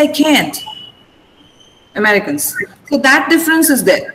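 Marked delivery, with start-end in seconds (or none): 0.0-0.6 "can't" again with the flat American "a" of "cat"; a light American "I can't"; American accent. Retold or imitated. The teacher repeats the American version for her class, "can't" with a flat "a". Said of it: "They say".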